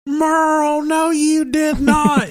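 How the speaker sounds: high, slow, scandalized wife voice; appalled wife. Retold or imitated. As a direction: stretch it out in disbelief. Wife appalled at husband's accident.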